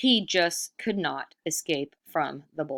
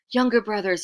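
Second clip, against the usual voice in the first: sweet-talking, a bit louder; wheedling trickster; 5 semitones higher, 7 dB louder than usual. Acted. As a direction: coax them like a con man. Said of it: "he said to the buffaloes".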